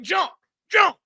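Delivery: high mock yell; threatening shout. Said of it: "It would just sound like".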